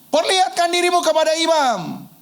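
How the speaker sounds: high call across a distance. Retold or imitated. Jesus repeating his call to the lepers, high shout. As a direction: project it to people far off. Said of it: "mereka pada teriak-teriak".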